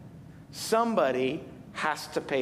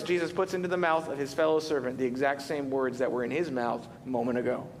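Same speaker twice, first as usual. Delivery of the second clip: ordinary delivery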